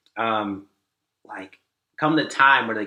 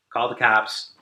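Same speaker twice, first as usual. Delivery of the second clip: ordinary talk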